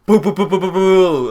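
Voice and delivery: rapid loud high boo-boo-boo; imitated notification noise. News alerts popping out of nowhere, rapid imitated noises.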